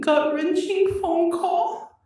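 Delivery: crying through the words; choked, slow and strained; voice breaking. Real, not acted. Breaking down mid statement.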